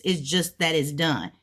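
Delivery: ordinary talk, nothing marked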